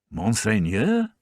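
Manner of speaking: slow, rising, puzzled "Monseigneur?"; puzzled repeat. A narrator's reading. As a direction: echo the word in disbelief. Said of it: "he repeated".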